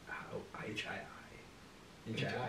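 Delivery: whispered spelling, slowly. Real, not acted. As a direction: spell it under your breath. Spelling the name to himself.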